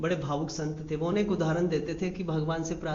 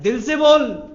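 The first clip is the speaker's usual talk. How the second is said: forceful urging; 4 semitones higher, 8 dB louder, slower than usual. Real, not acted.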